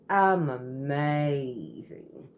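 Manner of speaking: "amazing" stretched long and slow; slowly drawn-out "I'm amazing"; dragged-out word. Retold or imitated. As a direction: drag out the word "amazing" like she's a little drunk. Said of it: "how she drugged the word out".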